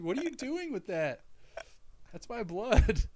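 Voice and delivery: high-pitched mock outrage; protesting kid. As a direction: imitate a child upset about his blood. Retold child protests, comic.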